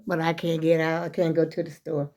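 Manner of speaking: ordinary talk, nothing marked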